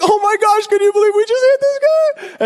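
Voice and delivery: very high squealed excitement; giddy disbelief. Retold or imitated. Boys in the car after egging a moving car, squealing excitedly. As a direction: squeal it in mock teenage amazement. Friends thrilled at hitting car.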